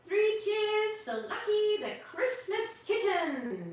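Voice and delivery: loud, high and slow; booming Wise Owl; booming cheer. A narrator's reading. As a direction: call the cheers to the whole forest. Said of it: "boomed Wise Owl".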